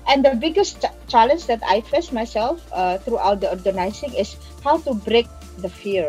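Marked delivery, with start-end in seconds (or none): none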